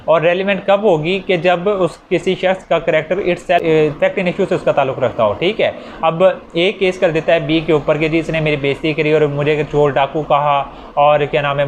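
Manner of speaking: ordinary talk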